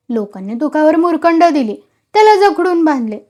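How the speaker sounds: plain reading voice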